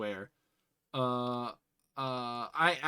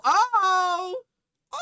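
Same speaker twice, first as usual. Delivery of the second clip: startled cry; 7 dB louder than usual. Real, not acted. Startled by scary ad.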